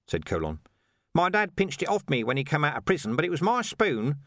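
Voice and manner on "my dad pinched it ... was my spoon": higher-pitched, insistent character voice; persistent. A narrator's reading. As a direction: press the point like an aggrieved Nobby. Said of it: "said Nobby persistently".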